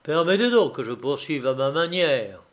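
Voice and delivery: plain reading voice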